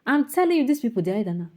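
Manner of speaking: indignant opening burst; heated outburst. Real, not acted. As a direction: jump in hot and insistent. Angry rant at state people.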